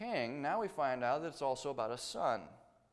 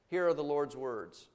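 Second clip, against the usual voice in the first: ordinary talk, nothing marked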